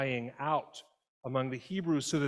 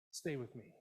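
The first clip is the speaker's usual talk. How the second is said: much softer, tender pleading; softer plea; 12 dB quieter than usual. Retold or imitated. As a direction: let it fall to an intimate plea. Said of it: "God says".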